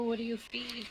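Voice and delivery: ordinary talk, nothing marked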